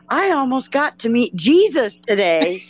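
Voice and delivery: mock-elated, high-pitched quoting; excited retold quote. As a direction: gush it as the thrilled aunt in a funny story. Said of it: "She was excited".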